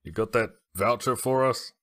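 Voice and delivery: loud shout across the tavern; hollered greeting to the barkeep; calling out. Acted. Player calls out to Reginald from a bench, loud. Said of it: "and say".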